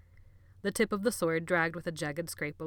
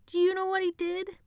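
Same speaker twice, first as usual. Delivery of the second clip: high, eager, gossiping glee; gleeful taunt; 7 dB louder than usual. Acted. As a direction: tease out the secret.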